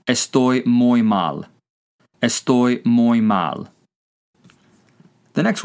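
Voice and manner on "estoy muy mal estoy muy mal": slow, evenly spaced words; careful, drawn-out demonstration; slow language-teacher model. Shown as a demonstration. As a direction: say it slowly for learners to repeat. Teacher models answer for learners.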